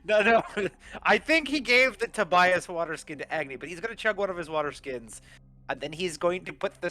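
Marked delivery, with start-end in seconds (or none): 0.0-1.6 loud, high laughing denial. Real, not acted. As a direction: protest through real laughter. Laughing along at the waterskin mix-up.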